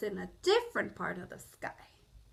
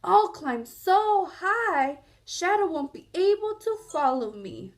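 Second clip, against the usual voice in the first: determined Bear; 5 semitones higher, 5 dB louder than usual. Acted. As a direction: vow it as the bear climbing away. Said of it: "thought Bear".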